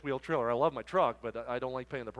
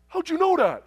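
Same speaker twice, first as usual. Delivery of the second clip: high, loud astonished question; hammed-up disbelief; mock amazement; 13 semitones higher, 10 dB louder than usual. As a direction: imitate a trucker caught out. Trucker amazed at being found out.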